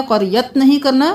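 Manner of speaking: ordinary delivery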